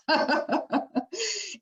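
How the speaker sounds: bright real laugh, high and airy; real laugh after the joke. Real, not acted. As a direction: burst into a light high laugh. Laughing at her own joke.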